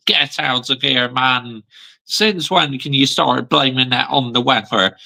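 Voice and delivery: ordinary talk, nothing marked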